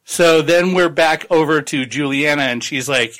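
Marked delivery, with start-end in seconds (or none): none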